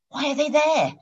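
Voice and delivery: high-pitched worried demand; alarmed, slightly frustrated. A narrator's reading. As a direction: ask as a concerned, frustrated boss. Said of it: "Alarmed and concerned and just a little frustrated".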